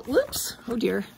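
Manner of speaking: usual speaking voice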